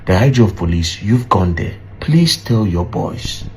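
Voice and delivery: ordinary delivery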